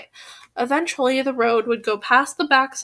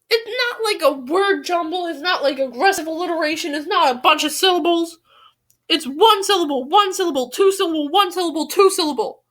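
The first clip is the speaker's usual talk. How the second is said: higher-pitched frustrated counting; exasperated rant; 4 semitones higher than usual. Real, not acted. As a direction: rattle off your complaint at yourself. Ranting at self, counting syllables.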